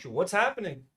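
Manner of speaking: ordinary talk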